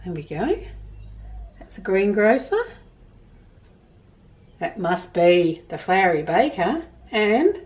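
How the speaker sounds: put-on gentle storybook reading to children; reading a story aloud. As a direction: read it like a picture book to kids. Reads picture book to children.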